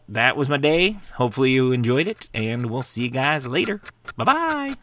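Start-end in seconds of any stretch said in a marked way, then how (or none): 4.2-4.8 much higher, squeaky sing-song goodbye; squeaky bye-bye. Pretend. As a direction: chirp it out like a cartoon. Cutesy sign-off.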